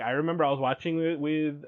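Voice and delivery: ordinary delivery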